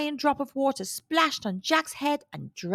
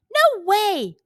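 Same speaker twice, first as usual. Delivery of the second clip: higher, louder protest; indignant cry; 9 semitones higher, 8 dB louder than usual. A narrator's reading. Jack stops his mother cooking the hen, crying out. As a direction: refuse to let the hen be eaten. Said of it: "cried Jack".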